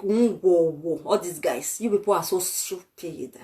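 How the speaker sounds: a low, soft, sneering mock voice for the listeners' heads; mocking their thoughts. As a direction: drop low and quiet, sneer it out as their inner voice. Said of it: "see as una head be like".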